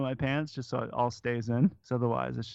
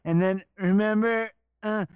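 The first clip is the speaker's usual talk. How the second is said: strained, higher-pitched fake storytelling; mock awkward, over-bright reminiscing; forced enthusiasm; 7 semitones higher, 3 dB louder than usual. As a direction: force out a stilted anecdote. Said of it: "when you're forcing it out".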